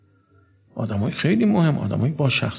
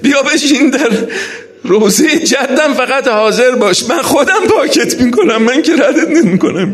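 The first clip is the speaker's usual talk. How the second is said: very high, loud lamenting cry; raised, emotional mourning-sermon delivery; impassioned tearful preaching; 9 semitones higher, 7 dB louder than usual. Acted. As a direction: preach at full force, nearly wailing. Preacher's climactic tearful exhortation.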